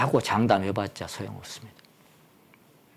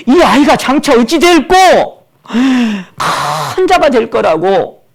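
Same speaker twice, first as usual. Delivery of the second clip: high, loud, drawn-out exclamation; loud marvelling cry; 12 semitones higher, 10 dB louder, slower than usual. Acted. Preacher voices people marvelling at the newborn, loud and high. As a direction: cry it out slowly in wonder. Quotes people's hopes for the child.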